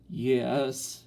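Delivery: drawn-out, gasping yes; gasped. A narrator's reading. Boy answers father after spanking, gasping. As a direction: gasp out the answer. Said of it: "Soupy gasped".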